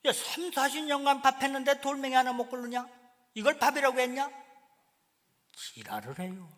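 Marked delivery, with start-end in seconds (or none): 0.1-4.3 loud mock nagging, higher; 밥 타박하는 남편 흉내, 높고 크게; scolding husband. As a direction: bark at your wife over bad rice.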